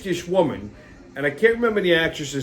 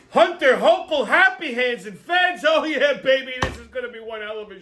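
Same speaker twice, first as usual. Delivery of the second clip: high-pitched, excited announcer hype; hyped show intro; 9 semitones higher than usual. Acted. Host kicking off the next part of the show, hyped announcer. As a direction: whip up the fans with a rising voice.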